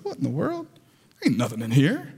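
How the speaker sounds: quiet, rising What then a low deflated Ain't; disappointed surprise. Retold or imitated. Man opening tiny box, baffled then deflated. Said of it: "I was like".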